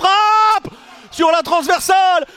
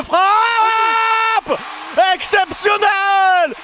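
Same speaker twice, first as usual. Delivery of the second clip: shouted goal call, slower at first; excited sports commentary; 3 semitones higher, slower than usual. Real, not acted. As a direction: yell the stunning strike.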